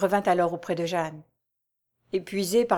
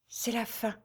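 much softer confession; faltering; 3 semitones higher, 10 dB quieter than usual. A narrator's reading. Jeanne confesses her hunger, soft and faltering. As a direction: barely get it out. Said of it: "elle balbutia".

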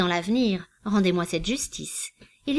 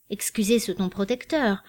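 usual speaking voice

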